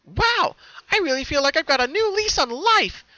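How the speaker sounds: high, loud delighted pony voice; thrilled with new life; high giggly cartoon pony; giggly joy. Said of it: "she giggled to herself".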